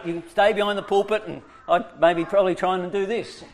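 normal talking voice

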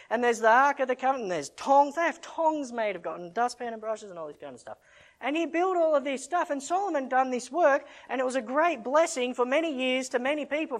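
loud, rising sermon push; full-force preaching. Real, not acted. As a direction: preach it hard to the congregation.

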